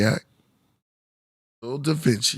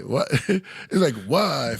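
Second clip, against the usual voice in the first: ordinary talk, nothing marked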